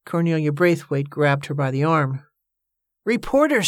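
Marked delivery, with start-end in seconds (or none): 3.0-3.7 high, excited announcement. A narrator's reading. Woman breaking news of reporters to her friend, excitedly. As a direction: burst out with the news, pitched well up. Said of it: "she said excitedly".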